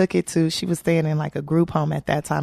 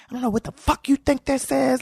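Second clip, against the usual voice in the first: higher-pitched angry retelling; going off; 7 semitones higher than usual. Retold or imitated. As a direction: imitate a comic telling someone off. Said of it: "he was going off on me".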